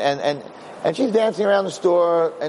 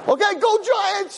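high, slow half-hearted cheer; mock cheer. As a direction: cheer along to please him. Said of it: "She's screaming".

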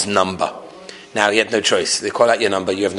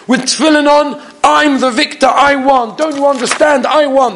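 much higher, loud defiant cry to the crowd; calling out; 6 dB louder than usual. Retold or imitated. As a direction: call across the crowd, high and triumphant. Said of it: "he called out in Yiddish".

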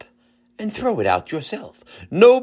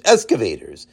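ordinary delivery, nothing marked

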